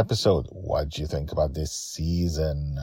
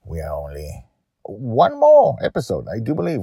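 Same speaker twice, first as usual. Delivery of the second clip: usual speaking voice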